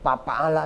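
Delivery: "fa'ala" said as pa'ala; p replacing the f in fa'ala; wrong p sound. Shown as a demonstration. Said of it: "ini salah".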